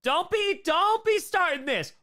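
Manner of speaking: high, loud mock scolding; hammed-up scolding of a friend. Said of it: "mock scolding".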